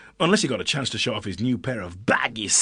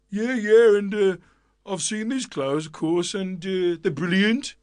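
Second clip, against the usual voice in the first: a townsperson pretending to have seen the clothes; bluffing townsperson; 7 semitones higher, slower than usual. A narrator's reading. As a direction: fake confidence, stalling. People pretending they saw.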